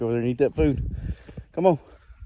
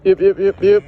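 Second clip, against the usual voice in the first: calling out; 6 semitones higher, 9 dB louder than usual. Real, not acted. Calling animals to feed.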